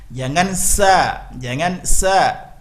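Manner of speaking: "sa" said slowly as a plain s, the wrong version of sya. Shown as a demonstration. Teacher demonstrating the wrong sa sound. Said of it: "Berubah hurufnya, tidak boleh".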